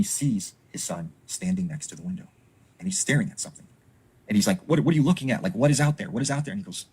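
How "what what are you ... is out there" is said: higher, louder frightened questions; father startled, asking what's outside; alarmed father. Retold or imitated. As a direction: demand it in a panic. Father frightened at son by window.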